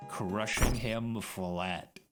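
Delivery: usual speaking voice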